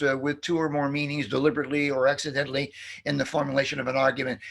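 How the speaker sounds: normal talking voice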